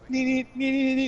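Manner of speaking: high buzzing clipper sound; clipper buzzing noise. Retold or imitated. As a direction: imitate nose hair clippers. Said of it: "I was like".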